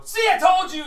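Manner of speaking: high, loud gloating shout; triumphant guard. Acted. Guard gloats to his partner, loud and high. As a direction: crow that you were right all along. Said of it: "you hear the guy go".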